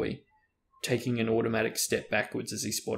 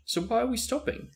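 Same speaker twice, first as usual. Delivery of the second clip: puzzled; 13 semitones higher than usual. A narrator's reading. Train stops unexpectedly.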